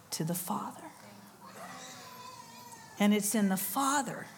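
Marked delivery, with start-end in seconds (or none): 0.1-0.8 hushed breathy whisper; awed whisper at the climax. Real, not acted. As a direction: drop to a reverent whisper. Climax of the story.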